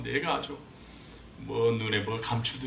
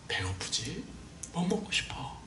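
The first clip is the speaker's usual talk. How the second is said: much softer, nearly whispered care; tender question; 4 semitones higher, 9 dB quieter, slower than usual. Shown as a demonstration. Preacher showing the congregation the tender words, softly. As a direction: murmur it gently to a child.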